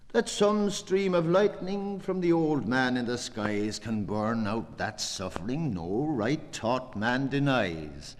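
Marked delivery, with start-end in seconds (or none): 0.1-3.8 high, loud invocation; impassioned declaiming. Acted. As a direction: lift the line up to the skies.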